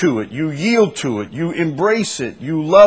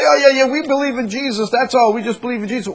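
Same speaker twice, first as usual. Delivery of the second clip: high, rattled-off mock brush-off; dismissive mimicry; 11 semitones higher, much faster than usual. Preacher imitating believers who skip doctrine, dismissive. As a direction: race through it high, waving the question away.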